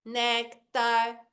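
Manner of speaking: "nectar" in two robotic beats; playful robot. Shown as a demonstration. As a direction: split "nectar" evenly like a robot. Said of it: "você fala brincando igual robô".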